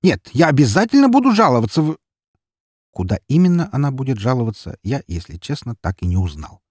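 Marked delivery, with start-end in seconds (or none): 0.0-2.0 high, loud threat rising until cut off; indignant outburst. A narrator's reading. Neighbour woman vows to complain, loud and indignant. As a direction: escalate shrilly into an unfinished threat.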